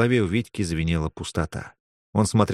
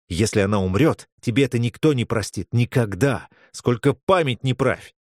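usual speaking voice

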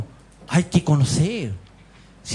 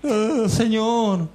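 high, drawn-out imitation of wailing; tearful plea to God, exaggerated and high; mock whining lament; 6 semitones higher, much slower than usual. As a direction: stretch it out like a hammed-up complaint. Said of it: "después lloramos y nos quejamos".

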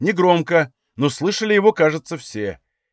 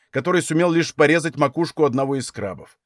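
plain reading voice

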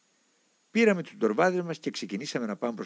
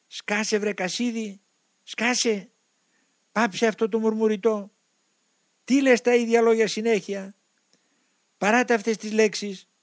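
high, raised voice of an angry demon; shouting demon; 8 semitones higher, 4 dB louder than usual. A narrator's reading. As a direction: demand silence as a raging demon. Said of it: "αλλάζοντας τη φωνή του, άρχισε να φωνάζει". (οργισμένη, ψηλή φωνή δαίμονα)

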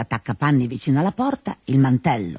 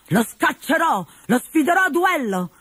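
put-on high, heated young hero vowing a duel; fiery Arlecchino; 11 semitones higher than usual. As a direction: puff up and declare it hotly. Arlecchino angry.

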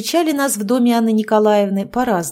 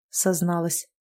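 plain reading voice

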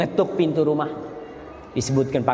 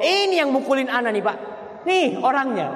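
high, accusing tattletale voice; complaining wife; 10 semitones higher than usual. Acted. Wife pointing out her husband to her father, high accusing.

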